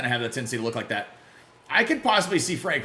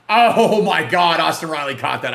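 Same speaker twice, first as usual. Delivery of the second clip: shouting in amazement, much louder; stunned shout; 4 semitones higher, 11 dB louder, slower than usual. Real, not acted. Streamer amazed as Austin Riley makes the catch, loud surprise. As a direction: blurt it out at a great catch.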